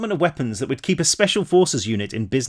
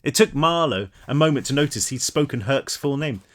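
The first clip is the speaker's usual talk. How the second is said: ordinary delivery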